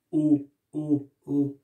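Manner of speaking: O sung out round and held; the O sound. Shown as a demonstration. Teacher isolating the vowel O for pupils. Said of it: "la syllabe O".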